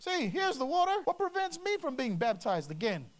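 high, excited acted question; eager request. Eunuch to Philip on seeing water, acted eagerness. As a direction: pipe up high as if you just spotted it.

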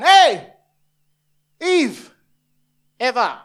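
high, loud call across a distance; yelling snake. Acted. Snake calling Eve across the garden, yelling. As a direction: holler out to someone far off. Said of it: "the snake was yelling across the garden".

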